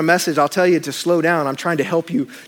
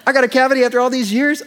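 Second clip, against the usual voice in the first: incredulous; 6 semitones higher than usual. Retold or imitated. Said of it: "I said".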